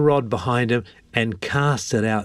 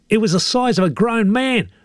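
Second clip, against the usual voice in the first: high, put-on old fisherman voice; high-voiced fish-tale boaster; boastful old Bill; 14 semitones higher than usual. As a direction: brag in a high character voice. Bill tops Ted's claim.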